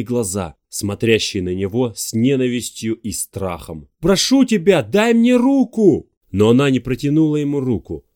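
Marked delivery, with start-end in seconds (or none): 4.0-6.1 pleading shout. Acted. Pleading at cliff edge.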